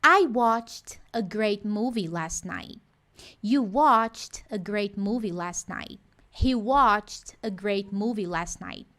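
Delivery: slow, each word of watched a great movie laid out; slow model sentence. Shown as a demonstration. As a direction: read it slowly like a pronunciation model. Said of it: "model sentences".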